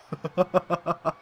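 long, breaking-out cackle; gleeful laugh. Real, not acted. Player laughing after a big hit, gleeful. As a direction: laugh it off in triumph.

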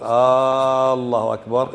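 "الله أكبر" said as "Aaallahu akbar"; very slow "الله", first vowel stretched as if asking; lengthened first vowel. Shown as a demonstration. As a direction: hold the opening A of "الله" far too long. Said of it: "إيش يصير هذا لو قلت".

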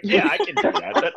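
high, laughing yeah; laughing self-defense; laughing reply. Retold or imitated. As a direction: brush it off through a laugh. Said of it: "And I was like".

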